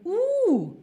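long, high hoot of dismay; exaggerated "ooh". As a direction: draw out a high, playful ooh. Reacts to a difficult Dresden accent.

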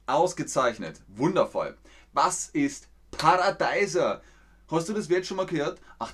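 usual speaking voice

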